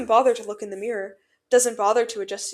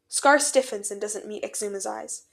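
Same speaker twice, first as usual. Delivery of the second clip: plain reading voice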